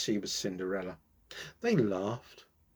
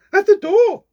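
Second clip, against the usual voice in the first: very high, loud shriek; screaming stepsister; 14 dB louder than usual. A narrator's reading. Stepsister spots the prince arriving, shrieking loudly. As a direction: shriek it out in a panic. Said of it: "screamed the other stepsister".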